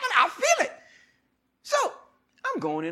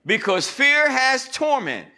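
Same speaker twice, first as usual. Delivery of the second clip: preaching scripture; 8 semitones higher, 8 dB louder, slower than usual. Acted. Returns to scripture cadence.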